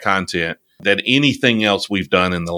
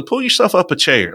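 Southern drawl; 7 semitones higher than usual. Retold or imitated. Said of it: "But I was like".

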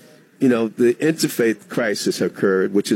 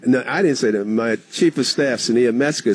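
normal talking voice

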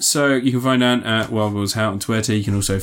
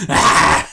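mock villain yell; 4 semitones higher, 6 dB louder than usual. Continuing Kurgan movie impression.

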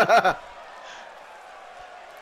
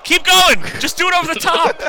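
excited cheering; 10 semitones higher, 8 dB louder than usual. Real, not acted. Egging on the wrestler.

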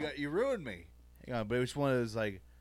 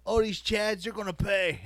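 raised, forceful mob boss bluster; mock tough-guy impression, pitched up; Tony Soprano; 5 semitones higher than usual. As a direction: do a hammed-up mobster laying down a threat. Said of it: "It's hard for me to do like Tony".

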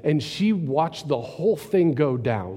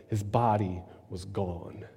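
normal talking voice

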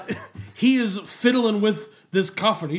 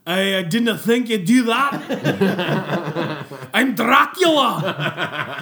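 slow, laughing Scottish brogue; hammed-up Scottish Dracula; Scottish accent; 3 dB louder, much slower than usual. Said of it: "picks up a Scottish accent".

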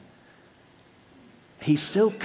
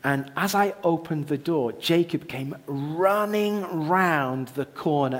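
ordinary talk, nothing marked

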